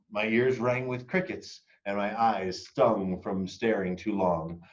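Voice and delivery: ordinary delivery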